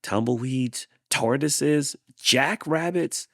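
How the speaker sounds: exasperated. Acted. Hank complaining about all his visitors. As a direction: build to a fed-up outburst. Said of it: "said Hank".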